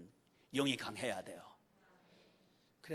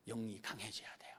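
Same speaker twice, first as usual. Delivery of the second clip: whispered emphasis; 11 semitones lower, 10 dB quieter than usual. Acted. Preacher whispers the key point to the congregation. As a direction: say it in an intense whisper.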